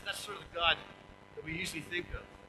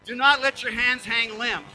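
proclaiming; 4 semitones higher, 10 dB louder than usual. Real, not acted. Rereading verse with force.

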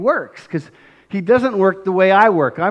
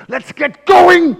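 much higher, slow shout; shouted demand; 13 semitones higher, 9 dB louder, slower than usual. Retold or imitated. Grabbing Jesus by the ears, a slow high shout. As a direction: yell it right in his face. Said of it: "grabbed them by the ears and said".

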